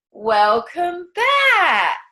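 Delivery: high, loud, stretched-out welcome; bright welcome back. Acted. Coach greeting the group after meditation, drawn-out cheer. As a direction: call them back, beaming.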